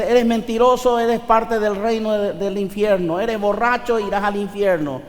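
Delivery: forceful, uncompromising hellfire warning; blunt, direct condemnation. Acted. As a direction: lay down the verdict with hard force. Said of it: "La Biblia te dice directamente".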